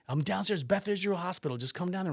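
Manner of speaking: high-pitched mimicked phone plea; imitated urgent insistence. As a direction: urge him down like an excited friend. Friend's urgent phone plea retold.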